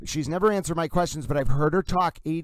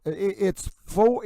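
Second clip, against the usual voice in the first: normal talking voice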